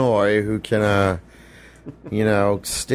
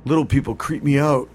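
higher, dumb jock voice; stupid jock voice; 4 semitones higher than usual. Acted. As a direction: play a lazy meathead kid. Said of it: "every voice we've done has been a stupid jock voice".

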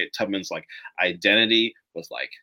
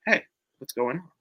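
soft, kind check-in; gentle greeting; 4 semitones higher, 4 dB quieter than usual. Retold or imitated. Harriet calming a panicking man, gently. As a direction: ease him down, light and kind. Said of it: "she was like".

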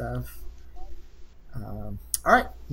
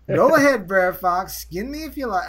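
attempted Southern accent, pitched up high; 6 semitones higher, 6 dB louder than usual. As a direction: try a hammed-up American South accent. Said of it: "I'll try my southern accent".

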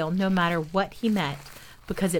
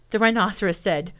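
usual speaking voice

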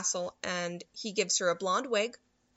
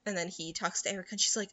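ordinary talk

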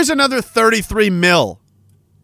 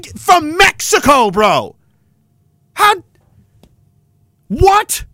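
real outrage shouted at the camera; yelling; 6 semitones higher than usual. Real, not acted. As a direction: shout it in disbelief. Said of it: "yell about it".

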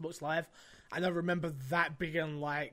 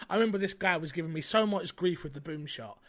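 normal talking voice

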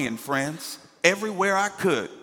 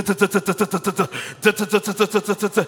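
rhythmic higher duh duh of scooter wheels; scooter noise; 4 semitones higher, 4 dB louder, much faster than usual. Retold or imitated. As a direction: tap out the scooter bumping the floor.

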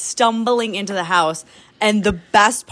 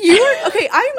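laughing; 13 semitones higher, 4 dB louder than usual. Real, not acted. Recalling running panic, laughing.

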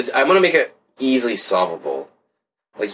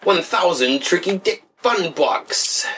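high, slow mock proclamation; pitched-up, hammed-up announcement; silly announcer voice; 8 semitones higher, slower than usual. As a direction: draw out the fake currency in a high voice. Mock announcement of foreign money.

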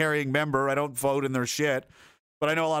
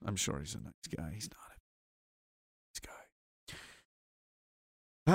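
sarcastic aside; 7 semitones lower, 11 dB quieter, slower than usual. Real, not acted. Sarcastic dropped voice.

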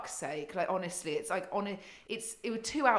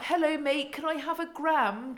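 high, loud mocking toff voice; mock posh voice; 9 semitones higher, 5 dB louder than usual. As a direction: lampoon a snooty rich customer. Mocking a customer buying drugs.

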